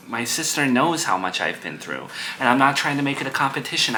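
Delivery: ordinary talk, nothing marked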